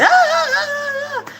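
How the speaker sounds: high-pitched mock screaming babble; everybody screaming over each other, exaggerated; imitated screaming chatter. Mimicking Africans talking like a fight, high mock scream. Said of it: "when we talk that we scream".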